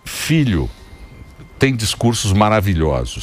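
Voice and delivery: low, measured elder's oration; mock-solemn speech. Old man lecturing the childless on parenthood, mock-solemn. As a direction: hold forth like an old sage.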